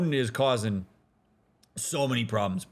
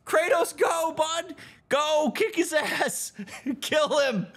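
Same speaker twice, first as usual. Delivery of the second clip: cheering him on; 13 semitones higher, slower than usual. Retold or imitated. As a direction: egg him on like a rowdy fan. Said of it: "all of us are like".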